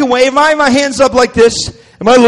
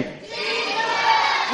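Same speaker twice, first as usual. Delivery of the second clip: ordinary talk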